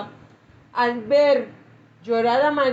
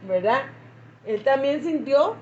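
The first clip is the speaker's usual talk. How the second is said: ordinary talk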